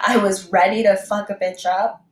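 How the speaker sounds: ordinary delivery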